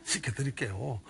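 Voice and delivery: quietly repeating the odd offer. Retold or imitated. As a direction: drop to a soft aside on 씻겨드릴게요. Shepherd's prayer, repeated.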